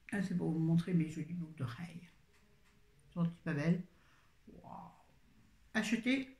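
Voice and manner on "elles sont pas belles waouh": slow hushed whisper over the earrings; a slow, secretive whisper of delight; admiring whisper. Real, not acted. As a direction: breathe it out in awe. Showing off her new earrings.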